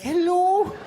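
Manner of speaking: high, loud, lilting phone hello; shrieky-high Scottish matron voice; Missus Doubtfire. Retold or imitated. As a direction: imitate a Scottish mom answering the phone. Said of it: "my mom sounds like Missus Doubtfire".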